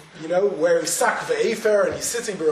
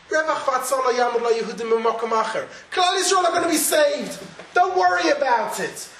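fervent insistence; 7 semitones higher, 4 dB louder than usual. Acted. Mordechai insisting to Esther.

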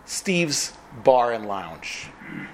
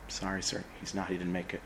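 softer, lower grave tone; grave news; 7 semitones lower, 13 dB quieter than usual. Acted. Nurse telling the patient the other driver died, soft and grave. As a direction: break it to him gently and low.

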